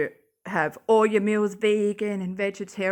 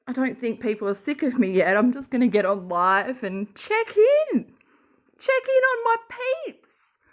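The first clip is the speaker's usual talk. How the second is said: mocking impression; 3 semitones higher, 3 dB louder than usual. Mocking Katie going live.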